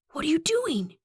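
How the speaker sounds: high-pitched hissed question; hushed but urgent hiss. A narrator's reading. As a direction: hiss it in alarm, high and tight. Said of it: "he hisses".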